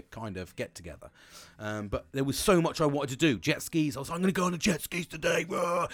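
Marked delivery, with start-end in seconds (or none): 4.2-5.5 eager. Retold or imitated. Retelling his holiday plans. 5.5-5.9 excited roar. Retold or imitated. Eager roar about the jet skis.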